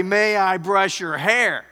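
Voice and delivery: loud slow shouted question; yelling. Retold or imitated. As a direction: yell it so a deaf man hears. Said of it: "she had to yell it".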